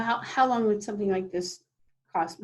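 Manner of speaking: ordinary talk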